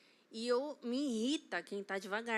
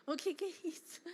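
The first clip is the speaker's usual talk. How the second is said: put-on child asking; 6 semitones higher than usual.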